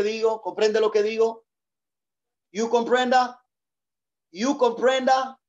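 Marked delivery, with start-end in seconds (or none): 2.5-5.4 joking broken English. Pretend. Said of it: "Pastor, no se dice así".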